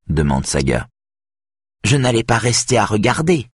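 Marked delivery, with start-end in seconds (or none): 1.8-3.5 higher voice with a small smile; a touch of amusement, lightly smiled; slight smile. A narrator's reading. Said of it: "répond Edith avec un petit sourire". (avec un petit sourire)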